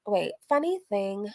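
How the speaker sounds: normal talking voice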